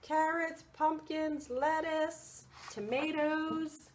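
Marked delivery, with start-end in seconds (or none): none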